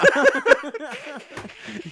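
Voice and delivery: high, long giggling fit; helpless laughter. Real, not acted. Co-host telling a vintage porn story, helpless giggles. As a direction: crack up at your own story.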